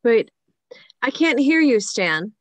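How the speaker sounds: straining to be heard on a payphone; shouting into phone. Acted.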